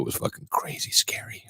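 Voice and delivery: hushed confession; whisper. Real, not acted. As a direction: drop to a whisper. Confiding fear in hospital story.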